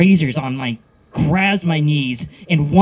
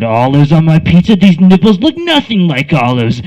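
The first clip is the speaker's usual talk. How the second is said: rising, louder complaint; mock indignation; 5 dB louder than usual. Retold indignant pizza complaint.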